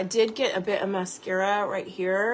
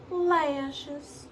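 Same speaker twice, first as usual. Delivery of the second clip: Lashes stretched out in a higher Southern Steel Magnolias accent; 6 semitones higher than usual. Shown as a demonstration. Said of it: "I always go into Steel Magnolia's accent when I say lashes".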